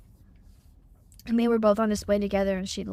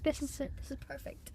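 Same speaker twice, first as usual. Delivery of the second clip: hushed delight; 5 semitones higher, 11 dB quieter than usual. Retold or imitated. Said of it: "she was just like".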